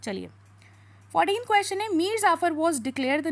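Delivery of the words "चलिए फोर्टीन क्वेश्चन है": normal talking voice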